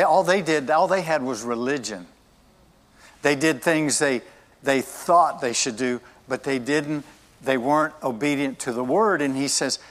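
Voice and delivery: usual speaking voice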